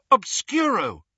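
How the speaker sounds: high, loud incantation; cried out spell. A narrator's reading. Hermione casting a blindfold spell on a portrait, cried out. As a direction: cry the spell out high and forcefully. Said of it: "Hermione cried".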